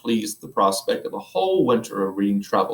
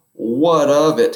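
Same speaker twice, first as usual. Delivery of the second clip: emphatic; 4 semitones higher, 7 dB louder, slower than usual. Acted. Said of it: "he repeated".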